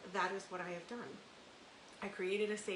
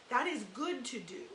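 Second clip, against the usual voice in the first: usual speaking voice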